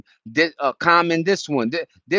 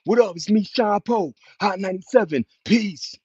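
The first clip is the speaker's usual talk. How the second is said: imitating [name]; 6 semitones higher than usual. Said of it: "the one that sound like Big".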